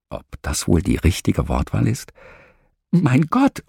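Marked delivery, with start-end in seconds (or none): 2.9-3.6 high panicked cry of Mein Gott; frightened cry. Retold or imitated. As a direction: gasp Mein Gott out in fear. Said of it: "ruft die Frau".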